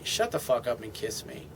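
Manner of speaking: low, soft, commanding growl of a line; a man pulling his girlfriend close with a low, firm command; masculine command. Acted. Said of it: "in a masculine way".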